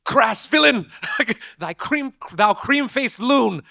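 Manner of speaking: high, loud, slow hammed-up theatrical declaiming; mock heroic knight shouting archaic insults; Shakespearean knight. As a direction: bellow it like a stage knight in old English. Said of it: "That's a Shakespeare quote".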